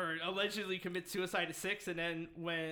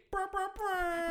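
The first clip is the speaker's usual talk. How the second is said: high, loud, slow rapper ad-lib; hype ad-lib; 14 semitones higher, 5 dB louder than usual. Pretend. Hyping the Bay Area.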